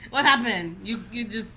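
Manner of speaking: loud mock scolding in a Haitian lilt; tough Haitian parent. Haitian parent confronts a child who cried, loud mock-stern lilt. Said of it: "being raised with tough Haitians".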